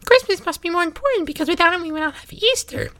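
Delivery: squeaky, very high cartoon mouse voice; really high-pitched cartoon voice; Mickey Mouse. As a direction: pitch it way up into a fast little squeak. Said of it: "like, a really high-pitched Mickey Mouse voice".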